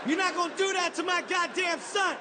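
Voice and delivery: rising comic outrage, ending in a shout; outraged father. Retold or imitated. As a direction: protest hotly on your son's behalf. Outraged father protecting his son.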